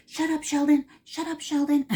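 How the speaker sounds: loud, high exasperated snap at the dog; mock scolding. Owner yelling at her barking dachshund, loud mock annoyance. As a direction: yell at a yappy dog to hush.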